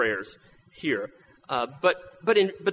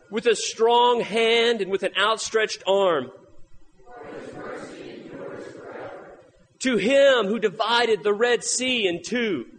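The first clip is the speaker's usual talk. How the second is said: scripture reading; 4 semitones higher than usual. Acted. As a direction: read it like scripture from the pulpit. Reads Psalm 136 aloud.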